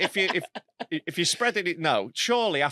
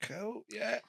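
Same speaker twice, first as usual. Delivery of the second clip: much softer, higher, uneasy mumble; awkward brush-off; 6 semitones higher, 12 dB quieter, slower than usual. Retold or imitated. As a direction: shrink away politely. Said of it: "but I'm always like".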